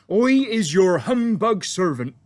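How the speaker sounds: friendly giant. A narrator's reading. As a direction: boom it out like an eager, bumbling giant. BFG voiced in story.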